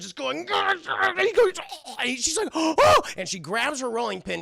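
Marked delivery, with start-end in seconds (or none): 0.4-1.1 high strained mock growl; hammed-up angry "grr"; strangling growl. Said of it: "Eustace strangling Courage". 1.9-1.9 high mock choking cry; strained cartoon noise; strangled cry. Dog being strangled. 2.5-3.0 loud, high mock gasp; hammed-up startled "oh"; shocked gasp. Wife gasps at shadow.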